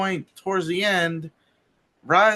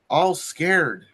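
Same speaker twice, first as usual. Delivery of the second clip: ordinary delivery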